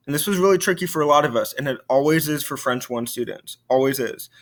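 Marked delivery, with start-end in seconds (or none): none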